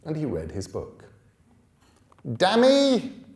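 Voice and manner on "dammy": loud furious yell from afar; shout. Acted. Angry boy shouting for the thief. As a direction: bellow it down the path. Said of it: "came a shout from down the path".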